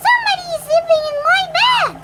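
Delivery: very high, loud, rapid squeak; piercingly high little bear squeak; squeaky baby bear. A narrator's reading. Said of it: "squeaky voice".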